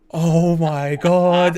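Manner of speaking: slow, loud mock disbelief; retold amazement. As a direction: draw it right out in hammed-up astonishment. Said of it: "looked at each other like".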